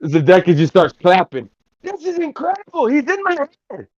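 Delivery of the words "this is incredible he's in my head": very high, softened puppet voice, amazed; thrilled puppet character. Acted. Puppet amazed by the voice in its head, high-pitched wonder. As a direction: marvel in a tiny high voice.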